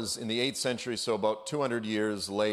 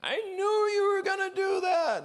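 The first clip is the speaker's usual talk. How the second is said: much higher, louder cartoonish shout; squeaky Satan whine; 3 dB louder than usual. Preacher voicing Satan outwitted by God, high cartoonish shout. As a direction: whine it like a beaten cartoon villain. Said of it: "and Satan's like".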